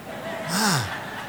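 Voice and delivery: a short, soft chuckle; soft laugh. Real, not acted. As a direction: chuckle fondly at the news. Laughs at the wedding news.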